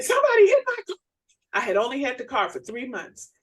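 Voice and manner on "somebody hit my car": much higher, louder mock wail; retold crying. Wife retelling her call to husband after the crash, mock wailing. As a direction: wail it like a distraught driver. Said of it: "And I called and I said".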